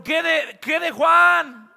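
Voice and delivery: slow, loud, high outburst of protest; indignant shout. Retold or imitated. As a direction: drag out "qué de Juan" as an indignant yell. Pedro protesting to Jesus.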